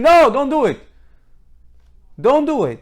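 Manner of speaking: loud, high cry of warning; acted-out scream of alarm; retold scream. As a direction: call out urgently as if stopping someone. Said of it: "cry to them and scream".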